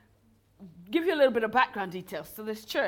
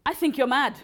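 louder, higher mock confrontation; blunt retort; 5 semitones higher, 6 dB louder than usual. Teammate confronting the deluded woman, loud and blunt. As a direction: tell her straight out, laughing at the memory.

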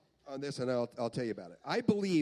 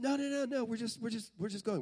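quick high no-no-no, then easing down; placating seatmate; 8 semitones higher than usual. Retold or imitated. Man next to boy on plane, placating. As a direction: hurriedly settle an overexcited child.

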